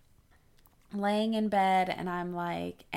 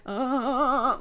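pained groan; 6 semitones higher, 5 dB louder than usual. Retold or imitated. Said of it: "I was like".

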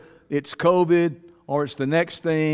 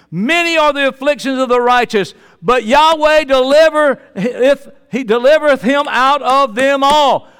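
fervent preaching; 9 semitones higher, 8 dB louder than usual. Acted. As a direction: drive each word home. Proclaiming scripture.

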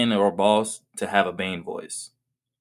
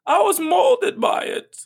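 mock Bane speech. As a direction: declaim like Bane, laughing at the end. Parody of Bane's famous speech.

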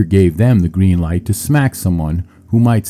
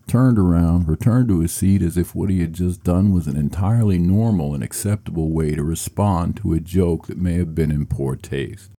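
ordinary talk

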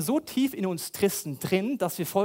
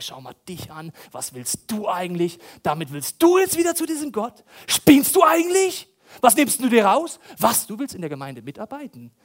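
accusing devil; 9 dB louder than usual. Pretend. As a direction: sneer and berate like the accuser.

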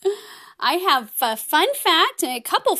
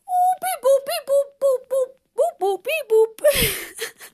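high-pitched robot beeping and booping; playful robot beeps; 10 semitones higher than usual. Pretend. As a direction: beep like a toy robot on the breath out. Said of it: "go beep, boop, beep every time we breathe out".

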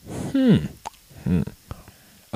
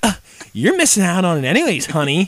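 mock sassy girl; 9 semitones higher, 4 dB louder than usual. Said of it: "she'd be like".

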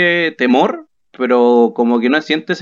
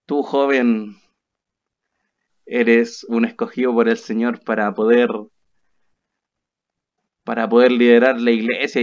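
solemn prophetess; 5 semitones lower than usual. Retold or imitated. Prophetess telling the young man his calling, solemnly. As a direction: pronounce it lower, with long solemn pauses. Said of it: "Y me dice así".

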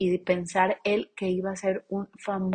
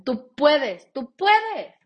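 high, loud tú puedes, climbing; building pep talk; rising encouragement; 7 semitones higher, 5 dB louder, much slower than usual. Acted. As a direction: cheer them on.

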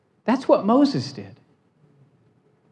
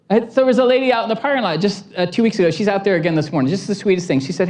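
ordinary talk

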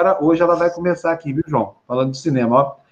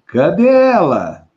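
cadê ela stretched long and louder; drawn-out call; 5 dB louder, much slower than usual. Real, not acted. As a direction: call out slowly for the guest. Host waits for guest to appear. (chamando devagar, arrastado)

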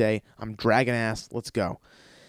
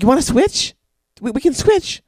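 higher, louder sweet offer; imitating fiancee; 12 semitones higher, 7 dB louder than usual. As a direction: lightly mimic her kind offer. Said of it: "She's like".

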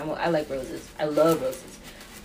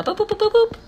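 playful boops; 3 dB louder, faster than usual. Real, not acted. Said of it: "checking the video length".

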